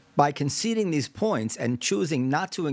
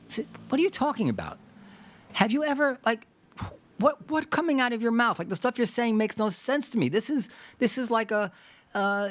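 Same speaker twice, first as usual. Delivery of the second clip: high-pitched, exasperated disbelief, acted; incredulous challenge; 7 semitones higher than usual. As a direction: challenge them as if baffled by nonsense. Imagined insider challenges the pundit.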